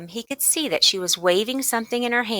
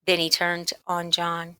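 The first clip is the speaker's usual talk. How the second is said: usual speaking voice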